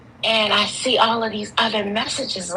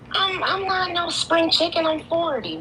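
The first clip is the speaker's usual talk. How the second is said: high-pitched retold protest; mildly exasperated, high-voiced retort; indignant comeback; 10 semitones higher than usual. Retelling her indignant comeback to him.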